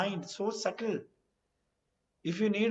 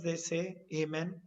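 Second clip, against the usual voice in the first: ordinary talk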